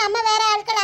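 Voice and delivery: usual speaking voice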